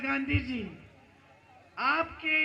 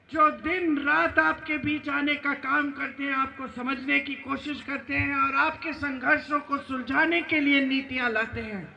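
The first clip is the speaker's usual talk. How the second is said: ordinary talk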